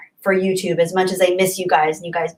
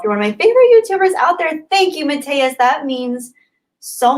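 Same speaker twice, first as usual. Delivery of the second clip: excited; 6 semitones higher, 4 dB louder than usual. Real, not acted. Excited praise to viewer.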